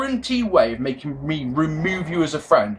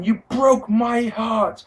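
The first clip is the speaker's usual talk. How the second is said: mock heartbroken shout; 7 semitones higher, much slower than usual.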